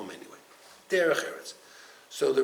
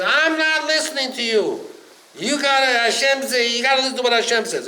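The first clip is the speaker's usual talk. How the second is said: much higher, louder defiant raven; 9 semitones higher, 11 dB louder than usual. Acted. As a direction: shout back stubbornly. Raven defies Noah.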